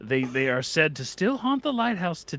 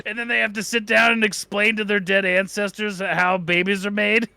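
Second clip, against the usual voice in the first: ordinary delivery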